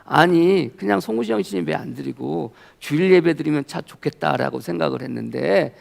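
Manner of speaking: ordinary talk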